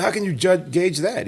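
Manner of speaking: high, loud disbelieving question; incredulous. Retold or imitated. Actor questions his manager's claim, high and incredulous. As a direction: pitch it way up, as if challenging a wild claim.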